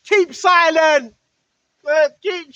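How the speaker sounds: loud, slow, drawn-out command; slow booming keep silent; mock emotional yell. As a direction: bark it slowly like a rattled spirit. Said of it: "they're gonna start getting emotional now".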